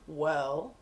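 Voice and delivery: lightly imitated deep-voiced lieutenant; a touch of a low, measured boss; Richards. Lieutenant leading an officer toward the charge, lightly voiced deep delivery. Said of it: "he talked in this really low, deep voice, slow".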